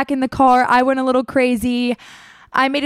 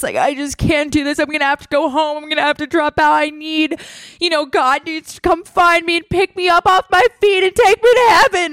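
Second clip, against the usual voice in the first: mock crying breakdown; 4 semitones higher than usual. Religious student breaking down to her, mounting distraught wail. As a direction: imitate an overwrought religious meltdown. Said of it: "everyone's gonna be having this crying breakdown".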